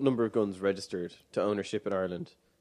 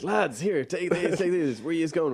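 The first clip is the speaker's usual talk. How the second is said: put-on old shopkeeper voice; 7 semitones higher, 3 dB louder than usual. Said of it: "he's just like".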